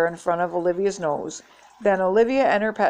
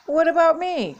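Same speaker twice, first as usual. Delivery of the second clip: indignant; 10 semitones higher, 3 dB louder than usual. A narrator's reading. Cassidy feeling left out.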